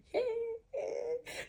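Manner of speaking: a high tearful cry of relief; emotional sob. Real, not acted. After the examiner says she passed, a tearful cry of relief. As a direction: let the relief break out in one crying sound. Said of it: "I don't want to be emotional".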